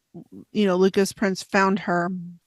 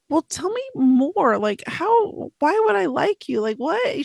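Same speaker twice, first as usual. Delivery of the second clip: questioning him, pitched up and slowed; quizzical higher voice; 7 semitones higher than usual. Retold or imitated. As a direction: ask it doubtfully, higher. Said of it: "Blanca keeps telling him".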